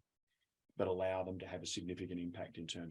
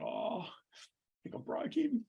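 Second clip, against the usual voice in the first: much higher, slow mock-panicked thought; alarmed inner thought; much slower than usual. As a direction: squeak it out in mock horror. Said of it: "I thought".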